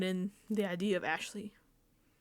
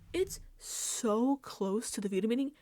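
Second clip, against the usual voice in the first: usual speaking voice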